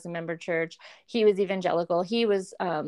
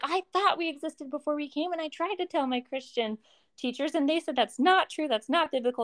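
imitated upset, pitched high throughout; high, pleading retelling of a tearful confession; tearful friend; 8 semitones higher than usual. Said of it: "she starts crying immediately".

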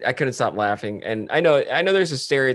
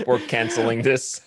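laughing mock rejection. Listener teasing the job applicant about a cancellation, laughing. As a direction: joke through your own laughter.

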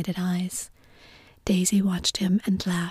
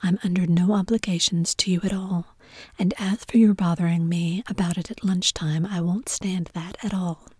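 plain reading voice

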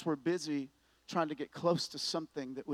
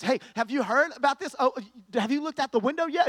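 louder, higher eager call; excited roommate; 8 semitones higher, 8 dB louder than usual. Retold or imitated. Roommate bursting in about snow, louder and eager. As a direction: burst in with the news.

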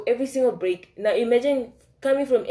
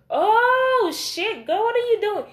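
very high, loud cry of shock; shocked exclamation; 6 dB louder, slower than usual. Retold or imitated. Catching Felicia in the guidance room, shrieking in shock. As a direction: shriek it like you just caught them red-handed.

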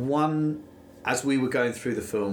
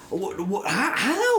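exasperated disbelief; 9 semitones higher, slower than usual. Retold or imitated. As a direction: squeak out total bafflement. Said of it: "You're looking at it thinking".

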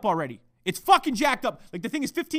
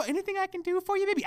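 high, softer sweet-talk imitation; mock cute fiancée; 10 semitones higher, 5 dB quieter, faster than usual. Podcaster imitating his fiancée's offer of help, mock cute. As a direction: mimic her acting all cute. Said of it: "Acting all cute and shit".

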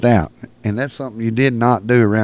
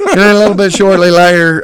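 ordinary talk

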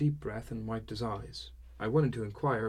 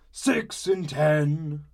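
slow, booming and far louder; 6 semitones higher, 10 dB louder, much slower than usual. A narrator's reading. A drunk dad booming across the tavern, loud and slow. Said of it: "began a single, excessively loud voice".

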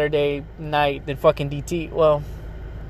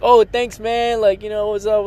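mock surprised thanks, pitched higher; surprised grateful guy; 6 semitones higher than usual. Mocking a guy thanked with a free drink, higher voice. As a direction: play the pleased stranger, higher and brighter. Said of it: "They're like".

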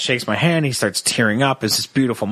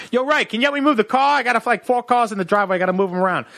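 much higher, eager shout across the driveway; guy calling out; 9 semitones higher, faster than usual. Retold or imitated. Partygoer calling to Ray for help moving cars. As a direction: call out to Ray from the front door.

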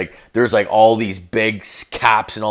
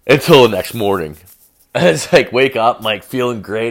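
ordinary talk

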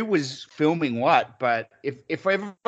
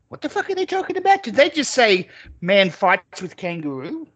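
stunned disbelief; 6 semitones higher than usual. Retold or imitated. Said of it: "I stopped and went".

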